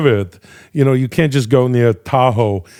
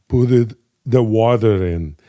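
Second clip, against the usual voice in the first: ordinary talk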